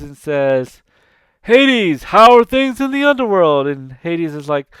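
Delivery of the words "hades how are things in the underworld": higher, louder booming jovial god; hearty Zeus greeting. Retold or imitated. Zeus greeting Hades at the party, loud and jolly. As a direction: greet him like a big cheerful king.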